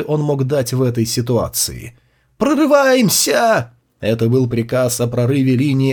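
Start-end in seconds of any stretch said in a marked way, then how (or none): 2.4-3.7 high, slow, loud war cry; battle shout. A narrator's reading. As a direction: roar the charge order. Order to break through.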